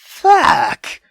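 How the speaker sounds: usual speaking voice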